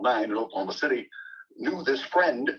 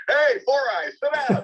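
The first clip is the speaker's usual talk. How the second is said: high, loud jeering call; shouted taunt from the crowd; mock heckle; 5 dB louder than usual. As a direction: heckle like a rowdy schoolkid, pitched way up. Acts out a classroom heckler.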